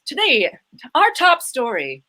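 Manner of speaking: slow newscaster read with rounded vowels; lightly voiced anchor, slow and round; Mid-Atlantic newscaster. Shown as a demonstration. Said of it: "it's all rounded vowels".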